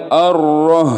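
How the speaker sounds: Ar-Rah with a heavy throaty ha; wrong big ha. Shown as a demonstration. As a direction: say Ar-Rah with ha besar. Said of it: "pakai ha besar". (Ar-Rah dengan ha besar, salah)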